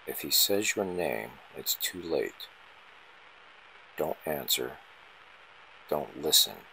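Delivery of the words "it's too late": usual speaking voice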